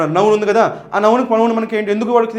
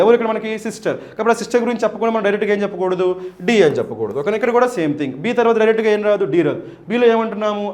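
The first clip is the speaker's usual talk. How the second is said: ordinary delivery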